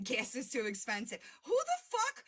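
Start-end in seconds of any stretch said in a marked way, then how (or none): 1.4-2.2 high-pitched disbelieving burst; incredulous outburst. Real, not acted. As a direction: fire it off in outraged disbelief. Outraged at a claim about her.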